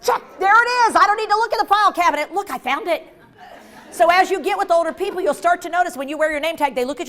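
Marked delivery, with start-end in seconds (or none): 0.0-3.0 high, loud, triumphant find; acted excited relief; gleeful discovery. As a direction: burst out as if you just spotted it. Acts spotting the name tag.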